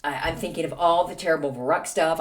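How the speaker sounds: ordinary delivery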